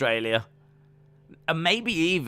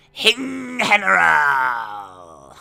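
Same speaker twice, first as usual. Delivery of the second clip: long falling holler, very slow; drawn-out shout; 3 semitones higher, 8 dB louder, much slower than usual. Pretend. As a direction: stretch the name into a huge call. Standalone drawn-out catchphrase shout.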